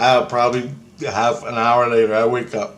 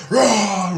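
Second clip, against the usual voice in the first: high, drawn-out imitated war cry; mock battle roar; 10 semitones higher, 3 dB louder than usual. Retelling the crowd charging at him, imitated roar. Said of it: "and everybody goes".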